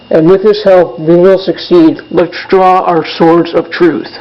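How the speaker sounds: measured, solemn declaration read aloud; formal speech. Acted. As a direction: deliver it like a pledge to the troops.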